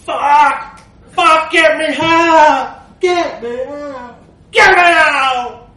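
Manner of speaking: panicked bellowing. Acted. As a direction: yell from inside the case to be let out. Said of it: "He started bellowing louder".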